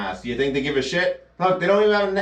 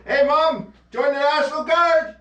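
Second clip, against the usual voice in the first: put-on higher, eager young son voice; voicing a young son; 9 semitones higher than usual. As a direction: play the naive kid announcing his plan. Son tells mom his plan.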